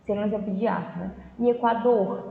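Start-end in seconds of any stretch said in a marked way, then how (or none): none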